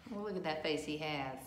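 ordinary delivery, nothing marked

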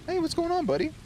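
high pet-talk greeting; cooing at a cat. Acted. Greeting another cat he meets, high cooing pet voice. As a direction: lift the voice up high for a furry buddy.

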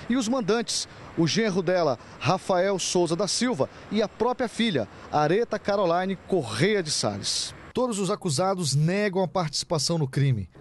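crisp, emphatic TV reporter read; measured, punchy reporting delivery; news report narration. Acted. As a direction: read it as a crime news voice-over.

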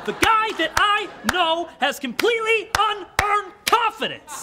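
high, loud sing-song cheer; rally him with big enthusiasm; rousing pep talk. Acted.

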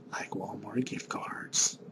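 breathy whispered aside; secretive whisper asking for Walmart gift cards. Real, not acted. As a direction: whisper it like a sly hint. Whispered repeat of the request.

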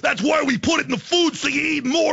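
high, shouted wrestler rant; wrestling promo. Pretend. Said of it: "No wrestling voices".

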